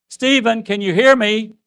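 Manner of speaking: a father screaming to a son who can't hear. Retold or imitated. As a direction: yell his name so he must hear. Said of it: "And I screamed".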